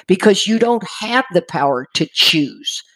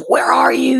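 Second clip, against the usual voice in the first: high-pitched mock yell at someone; demanding retold holler, pitched up; 7 semitones higher than usual. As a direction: imitate an alarmed friend yelling down the phone. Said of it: "he started yelling at me".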